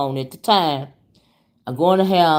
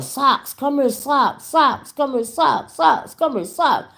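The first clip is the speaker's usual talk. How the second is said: mild mock holler, higher; calling the dog back in a raised pitch; 8 semitones higher, slower than usual. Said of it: "I'm hollering".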